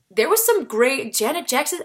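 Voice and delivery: ordinary talk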